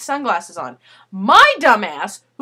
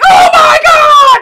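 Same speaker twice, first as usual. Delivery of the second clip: very high, loud, slow gasp of delight; surprised shout; 14 dB louder, much slower than usual. Retold or imitated. When the concert lights suddenly go out, loud thrilled shout. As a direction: let the surprise burst out.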